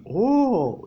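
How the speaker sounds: long admiring "oh", high and loud; a drawn-out "oh" of wonder; exclamación de admiración. A narrator's reading. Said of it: "que se sumó a los". (un «¡oh!» de admiración, largo y agudo)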